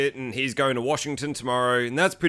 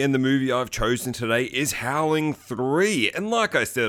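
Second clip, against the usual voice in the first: usual speaking voice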